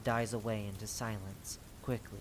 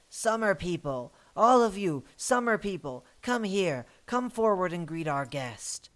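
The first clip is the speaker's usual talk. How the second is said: raised, higher call to a crowd; 5 semitones higher, 4 dB louder than usual. Acted. Voice one calls the summer people to greet a guest, calling out. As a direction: summon everyone forward with authority.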